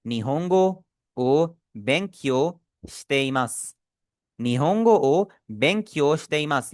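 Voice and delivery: slow, careful, syllable by syllable; slow model sentence. Shown as a demonstration. Teacher models the sentence slowly for students to repeat. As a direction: say it slowly for the class to copy. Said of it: "इसकी pronunciation को आप भी कोशिश करें".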